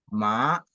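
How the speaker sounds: "ma" starting low and rising; second tone. Shown as a demonstration. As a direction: lift "ma" steadily like a question. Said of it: "le deuxième ton".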